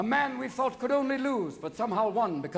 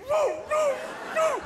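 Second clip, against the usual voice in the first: high imitated dog bark; Lassie; slower than usual. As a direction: yelp twice like an alert dog. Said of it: "What's wrong, Lassie?".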